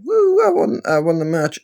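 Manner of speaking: squeaky high mock celebration, then it drops; hammed-up wrestler cheering his win; mock victory cheer. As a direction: ham up a giddy winner. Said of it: "Kota Ibushi is celebrating".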